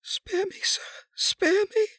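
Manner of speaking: very high, weak plea; faint pleading. A narrator's reading. Wife begs her husband for mercy, faintly. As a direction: beg faintly, voice thinning away. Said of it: "said Lady Eleanor faintly".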